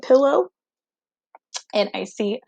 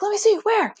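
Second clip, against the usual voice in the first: higher, keen roommate voice; eager roommate; 12 semitones higher than usual. Retold or imitated. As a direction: play the fearless roommate wanting a look. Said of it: "And she's like".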